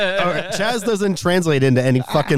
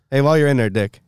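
ordinary talk

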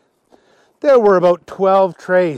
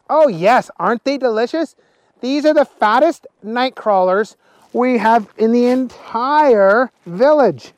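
higher, lively character voice; eager Willie, delighted; 7 semitones higher, 4 dB louder than usual. Acted. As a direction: rave proudly about a treat, pitched up. Willie proudly offers the worms.

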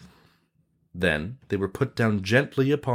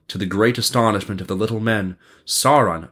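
ordinary delivery, nothing marked

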